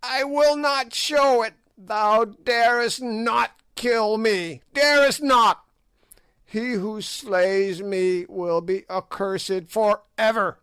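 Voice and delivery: high sneering witch; old witch voice. A narrator's reading. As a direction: play the ancient hag taunting the king. Gagool defies the spear.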